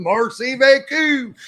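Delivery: laughing mock Florida French, slow and drawled; Florida accent. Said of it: "with his Florida accent".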